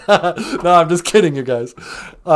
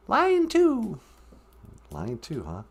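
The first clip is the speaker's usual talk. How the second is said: ordinary talk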